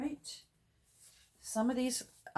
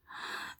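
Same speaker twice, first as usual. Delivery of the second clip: soft, sudden intake of breath; delighted gasp; 8 dB quieter than usual. Real, not acted. Crafter spots Christmas gnomes among old stickers, delighted gasp.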